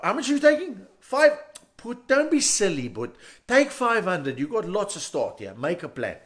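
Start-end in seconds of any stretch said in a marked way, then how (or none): 0.0-4.4 high, loud, jolly greeting; friendly ATM shout. Retold or imitated. The ATM greets a customer with money, cheerful shout. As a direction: call out like a pal waving you in. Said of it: "it sort of shouts out to you".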